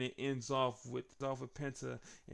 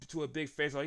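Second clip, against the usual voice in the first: usual speaking voice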